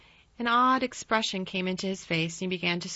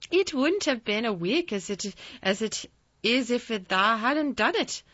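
country lad; 4 semitones higher, 3 dB louder than usual. A narrator's reading. Dickon speaks in higher dialect.

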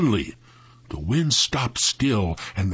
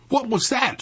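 high-pitched startled cry, little extra volume; mock yell; 14 semitones higher than usual. A narrator's reading. Sam startled by a growl, yelling in narration. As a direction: yell it high but contained. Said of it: "Sam yelled".

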